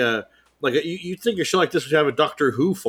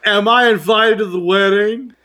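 mock hopeful; 8 semitones higher, 7 dB louder than usual. Mock-hurt teasing question.

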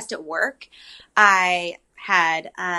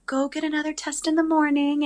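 gentle advice; 8 semitones higher, 3 dB quieter than usual. Retold or imitated. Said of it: "she's so sweet".

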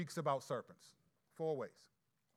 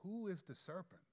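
quiet question; 9 dB quieter than usual. Real, not acted. Pastor quietly posing the riddle to his hearers. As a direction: lean in and ask it softly.

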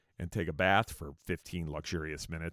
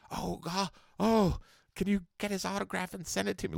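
high, pleading fanboy voice; starstruck fan; 11 semitones higher than usual. Retold or imitated. Drummer begging for his idols' autograph, starstruck. Voicing an eager fan's request.

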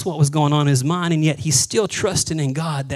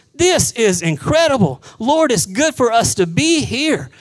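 higher, loud, awestruck outburst; higher, louder eager Peter blurting out his delight; excited Peter; 7 semitones higher, 4 dB louder than usual. Acted. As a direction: gush like Peter overwhelmed on the mountain. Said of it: "And Peter goes".

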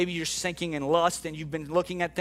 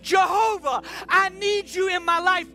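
high, forceful plea to Jesus; desperate cry; 13 semitones higher, 4 dB louder than usual. Acted. Peter begging Jesus to save him, desperate cry. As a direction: call out for rescue with everything. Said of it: "he was so desperate".

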